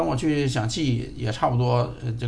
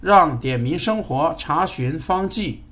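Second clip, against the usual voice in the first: slow clear command; 3 semitones higher than usual. Real, not acted. Command to device.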